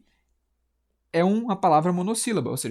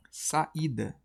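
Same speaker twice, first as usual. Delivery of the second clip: "Saída" softer, parted as sa; separated syllables; 5 dB quieter than usual. Shown as a demonstration. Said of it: "quando eu separo".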